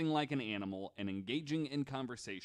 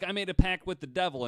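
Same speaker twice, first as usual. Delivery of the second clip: high, loud, rushed blurt; a girl blurting her secret, loud and hurried; mock confession; 9 semitones higher, 10 dB louder, faster than usual. Voicing Clara, loud and fast.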